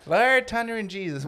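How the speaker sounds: put-on Newfie accent. Friend copying the Newfie oath, mock Newfie accent. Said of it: "I can't do the newfie accent".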